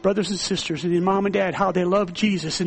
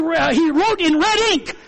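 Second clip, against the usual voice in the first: ordinary talk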